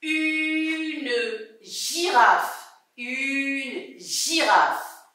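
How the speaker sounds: extremely slow, drawn-out listening model; stretched-out word. Shown as a demonstration. Teacher helps pupils listen for F, stretched-out word. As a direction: hold "Une" very long, then "girafe". Sounding out words to hear F.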